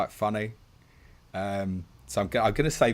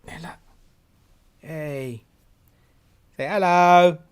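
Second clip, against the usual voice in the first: kissing it better; 8 semitones higher, 9 dB louder, slower than usual. Real, not acted. Coaxing a child on camera.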